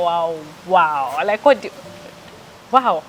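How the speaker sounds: ordinary talk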